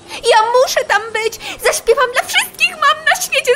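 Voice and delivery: high, dreamy excitement; fly lights up at the idea of singing; excited. Acted. Fly tells rat her big dream, giddy and high.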